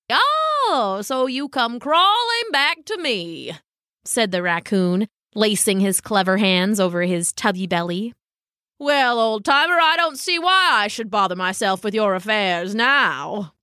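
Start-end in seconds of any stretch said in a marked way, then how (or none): 0.1-3.5 high, drawn-out "oh", then a slow gloat; gloating raccoon. Acted. Raccoon to the dog who insulted him, smugly gloating. 8.8-13.5 grandly dismissive raccoon character voice; aloof raccoon. Acted. Raccoon refusing the dog's plea, haughty.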